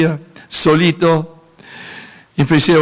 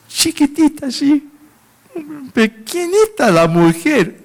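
lifted high to paint how small she was; tiny voice; 11 semitones higher than usual. Acted. Preacher picturing the tiny missionary woman in a high, small voice. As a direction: squeak it out, slowly.

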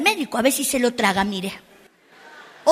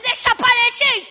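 high, slow mock order to vanish; angry mother; 10 semitones higher than usual. Mother tells her daughter to get lost, high and slow. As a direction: play a mom sending the kid away. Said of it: "Y después te dice".

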